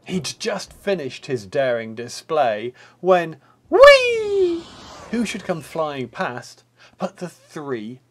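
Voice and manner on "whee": very high, loud gleeful whee. Acted. Pigs zooming past the wolf, high gleeful whee. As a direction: squeal whee as they zoom by.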